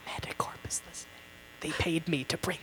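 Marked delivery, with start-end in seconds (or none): 0.1-1.1 fast fearful whisper. Acted. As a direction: hiss the warning quickly. Paranoid whisper.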